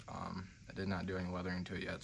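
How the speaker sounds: normal talking voice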